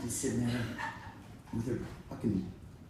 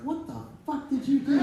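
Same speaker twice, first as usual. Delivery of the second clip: shouted much higher; angry shout; 12 semitones higher than usual. Retold or imitated. Dad yelling at his son at the meeting. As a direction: yell at him in disbelief.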